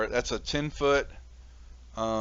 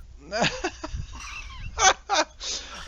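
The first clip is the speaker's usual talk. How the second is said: big laugh. Real, not acted. DM laughs at the jump plan.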